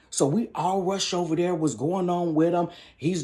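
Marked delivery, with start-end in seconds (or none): none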